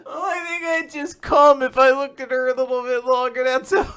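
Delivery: high-pitched comic fluster; hammed-up bashful voice; flustered character. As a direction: play a smitten, embarrassed lad up high. Acts flustered character, continuing the voice.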